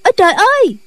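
put-on high, startled girl's cry; frightened cry. Two young nuns falling down the slope, high frightened cry. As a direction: yelp as you tumble. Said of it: "hai nữ đệ tử la lên".

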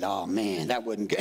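low, defeated mutter; dejected. Retold or imitated. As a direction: sigh it like a beaten man. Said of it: "shaking his head".

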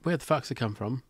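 ordinary delivery

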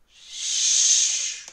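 long loud shushing whoosh; loud whoosh sound. Real, not acted. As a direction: make a rushing whoosh noise. Goat flying off.

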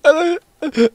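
high, fake teary whimper; mock crying. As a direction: ham up being overcome with emotion. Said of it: "don't cry, man".

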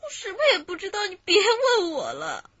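tearful protest that breaks into sobs; crying. Acted. Suspect begs the interrogator to stop questioning her, crying. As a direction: cry out, begging them to stop asking.

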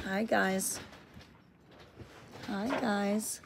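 soft, quiet hello to viewers; hushed hello. Real, not acted. Greeting viewers softly so Finn is not startled. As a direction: keep it down for the cat. Hushed near shy cat.

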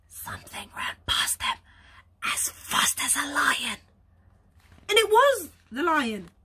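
ordinary delivery

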